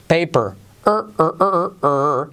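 "er, er" held as a long r-coloured schwa; drilled R sound. Shown as a demonstration. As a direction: drill "er" slowly, a vowel swallowed by the R. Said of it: "Entonces repite conmigo". ("er" pronunciado como una R genérica alargada)

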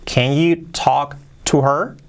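slow question in separated chunks; careful word-by-word teaching question; slow model. Shown as a demonstration. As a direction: space out the words, rise on "her". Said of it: "Más rápido".

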